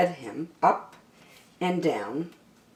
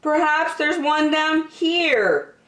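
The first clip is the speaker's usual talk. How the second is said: Douglas's voice; 11 semitones higher, 3 dB louder than usual. Acted. Douglas searching.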